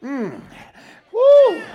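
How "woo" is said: high, loud preacher's "woo"; exultant whoop. Real, not acted. Preacher to his congregation mid-story, an exultant high whoop. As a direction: let out a sudden, joyful holler.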